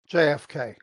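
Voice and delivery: ordinary talk, nothing marked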